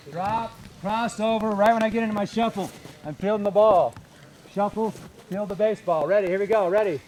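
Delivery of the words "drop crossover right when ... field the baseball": higher, slow call as he moves; slow demo call. Shown as a demonstration. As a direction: narrate the move slowly while doing it. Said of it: "Take a look".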